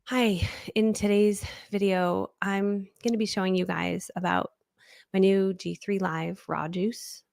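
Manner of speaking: dull, draggy video intro, slow at first; low-energy presenter. Shown as a demonstration. Coach showing students how not to open a video, low-energy. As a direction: drone it out with no energy. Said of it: "lower energy".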